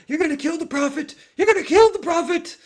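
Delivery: high, loud shrieking rant, rising to a scream; crazed ranting shout. Retold or imitated. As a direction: rant like a madman being hauled off. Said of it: "he's ranting".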